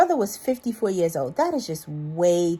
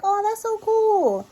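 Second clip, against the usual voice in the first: high, drawn-out gush; retold gushing; slower than usual. Hearing a character's niece share stories, gushing high. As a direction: coo it high and slow, impressed. Retells own delighted reaction.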